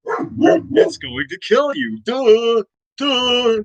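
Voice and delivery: high, slow sneering "duh"; mocking dumb voice. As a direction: talk down like explaining to a fool. Mocking common-sense lecture.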